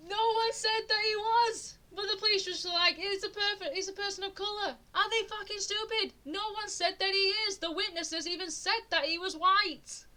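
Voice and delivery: high-pitched indignant rant; exasperated outburst. Real, not acted. Streamer rants at police who ignored the witnesses, exasperated and high. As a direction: pitch shoots way up in outraged disbelief.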